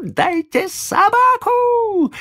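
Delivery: high, slow squealing demand; shrieking child. Acted. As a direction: squeal it like a spoiled boy. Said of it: "Взвизгивал мальчик".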